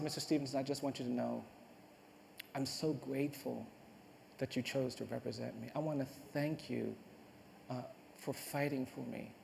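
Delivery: soft, halting retold thanks; hushed gratitude. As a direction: quietly voice a condemned man's heartfelt thanks. Retelling condemned man's last thanks.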